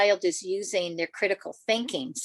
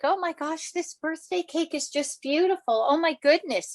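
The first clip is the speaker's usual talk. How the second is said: high, gushing mock delight; gushing brother; 9 semitones higher than usual. Brother gushing over his birthday cake, high and delighted. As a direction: gush over the cake like a thrilled kid. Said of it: "He was like".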